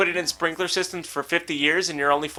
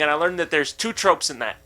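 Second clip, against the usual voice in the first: ordinary talk, nothing marked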